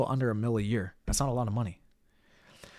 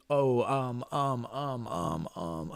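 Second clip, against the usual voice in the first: stalling on every um; mock a speaker who keeps hesitating; hesitant filler; much slower than usual. Shown as a demonstration. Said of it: "hesitate all the time".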